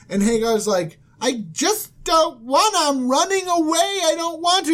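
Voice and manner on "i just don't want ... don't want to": mock whining. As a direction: whine it like a reluctant kid. Hagar protests being sent back.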